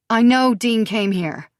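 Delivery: loud insistent demand. Acted. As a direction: demand an answer. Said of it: "she demands".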